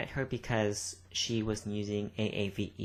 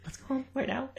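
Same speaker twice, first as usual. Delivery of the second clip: much higher, panicky mock plea; faster than usual. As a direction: squeak it out in fake fright. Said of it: "Southern accents fucking scare me".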